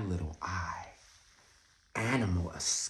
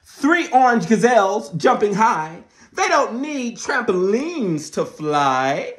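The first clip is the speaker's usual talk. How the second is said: loud, high dramatic read-aloud; excited read-aloud; storybook reading; 13 semitones higher, 14 dB louder, slower than usual. A narrator's reading. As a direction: announce it like big news to kids. Reading the book.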